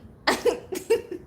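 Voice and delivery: rising high laugh; high giggle. Real, not acted. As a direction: giggle at the image. Laughing at the story.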